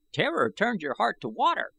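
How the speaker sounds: put-on high, reedy old man's voice, boastful. A narrator's reading. Old I Gos gloating to the young warriors, high and reedy. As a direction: crow about it like a proud old man.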